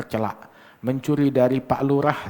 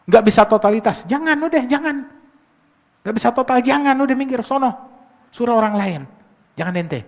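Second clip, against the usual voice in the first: loud, high-pitched warning; raised, insistent scolding; emphatic admonishing; 11 semitones higher, 6 dB louder, faster than usual. Real, not acted. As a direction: wave them off forcefully. Sternly warning off unfit reciters.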